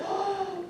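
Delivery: high, sharp intake of breath; startled gasp. Acted. Little girl seeing the cat, startled gasp. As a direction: gasp in acted fright.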